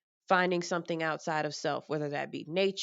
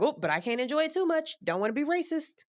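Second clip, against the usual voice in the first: higher, fast fretful voice; anxious second thought; 10 semitones higher, faster than usual. Retold or imitated. Self catching herself enjoying other cultures, high fast fretting. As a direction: fret quickly in a raised voice. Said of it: "And then be like".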